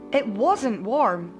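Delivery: ordinary delivery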